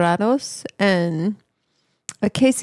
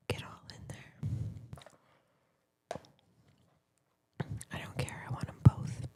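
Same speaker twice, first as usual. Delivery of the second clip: soft unvoiced whisper; 13 dB quieter than usual. Real, not acted. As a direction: breathe the words out. Whispering asides while eating.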